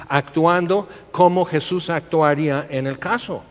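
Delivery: usual speaking voice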